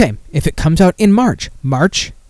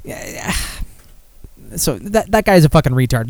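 ordinary talk, nothing marked

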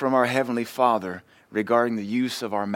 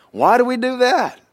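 exasperated; 13 semitones higher, 7 dB louder than usual. Real, not acted. Exasperated rhetorical outcry.

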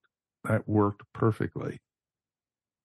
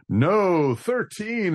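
higher, punchy movie-line quote; quoted film line; 11 semitones higher, much slower than usual. Retold or imitated. Fan re-enacting the film's big reveal, high and emphatic. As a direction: draw the line out, jumping high then falling.

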